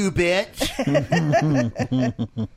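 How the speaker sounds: laughing mock yell; playful fake protest; mock outburst. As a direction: bark it while cracking up. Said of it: "Or you could have gone".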